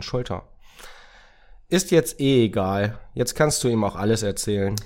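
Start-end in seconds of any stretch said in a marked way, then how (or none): 1.7-4.8 resigned dismissal. Acted. Racket gives up on secrecy.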